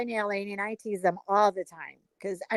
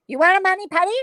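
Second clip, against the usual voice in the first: put-on raised, louder Chinese-accented question; mock Chinese accent; 5 semitones higher, 6 dB louder, much faster than usual. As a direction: imitate a nail tech's Chinese accent. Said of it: "she does a perfect Chinese person".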